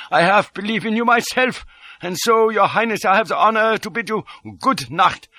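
high, formal foreign character voice; ceremonious farewell, pitched well up; grimly amused Detchard. A narrator's reading. Detchard bids the false prince good night, grimly amused. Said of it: "He smiled grimly".

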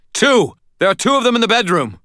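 high, loud, urgent shout; howled. A narrator's reading. Shouting to friend on the ladder, urgent howl. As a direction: yell the warning up to a rescuer. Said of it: "I howled".

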